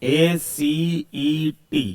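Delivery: एसीईटी spelled very slowly, each letter drawn out. Shown as a demonstration. Teacher spelling the name slowly for students. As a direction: draw out एसीईटी one letter at a time. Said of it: "common name क्या हो जाएगा".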